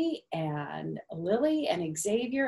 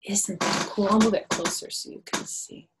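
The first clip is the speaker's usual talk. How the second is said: ordinary delivery, nothing marked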